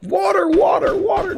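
high, loud urgent callout; shout. Real, not acted. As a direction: yell the warning to your squad. Calling out enemy in the water.

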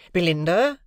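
husband protesting to his wife; remonstrating Mr Pocket. A narrator's reading. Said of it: "remonstrated Mister Pocket".

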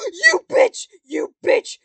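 very loud, furious accusation; high outraged yell, easing down in pitch; outraged shout. Real, not acted. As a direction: scream it at your opponent. Screaming at the computer opponent.

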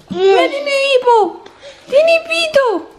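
very high, slow, giggling; high giggly cooing; laughing coo. Real, not acted. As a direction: laugh tenderly at the hiccuping puppy. Puppy has hiccups, amused.

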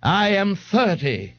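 slow, lofty, talking down; condescending. Acted. Ignatius answers the policeman's question, slow and condescending. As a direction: state it as if to a simpleton. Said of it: "Ignatius said condescendingly".

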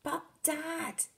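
put-on small, high, drawn-out boy's voice; pleading son. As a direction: wail it lightly to get Dad's attention. Son fails to get a word in.

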